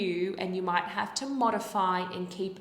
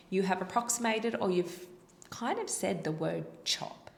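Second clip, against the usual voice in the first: usual speaking voice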